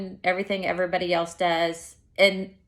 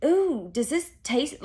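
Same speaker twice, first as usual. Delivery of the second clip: disgusted doubt; 6 semitones higher, slower than usual. Retold or imitated. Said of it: "I'm like".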